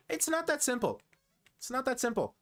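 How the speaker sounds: high-pitched objection, falling at the end; insistent protest. Real, not acted. Objecting to a chat remark.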